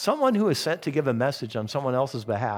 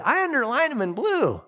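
high-pitched little lady voice; 9 semitones higher than usual. Retold or imitated. Little lady answering in Sunday school, high-voiced. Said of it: "this little lady said".

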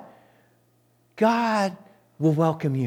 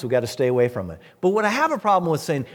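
normal talking voice

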